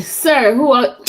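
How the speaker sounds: mock caller. Mimics caller addressing her as sir.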